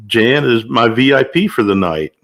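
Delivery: normal talking voice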